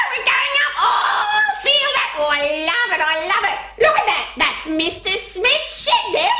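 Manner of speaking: high, squawking excited bird voice; cockatoo. Pretend. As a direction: gush with delight as a pretend cockatoo. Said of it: "pretend you're a bird".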